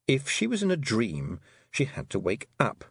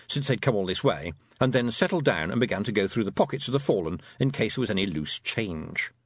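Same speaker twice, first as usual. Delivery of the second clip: ordinary delivery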